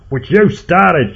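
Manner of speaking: high, loud, drawn-out yell; accusing shout. A narrator's reading. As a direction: shout the blame slowly, hitting each word. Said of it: "shouted Ed".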